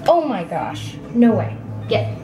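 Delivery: ordinary talk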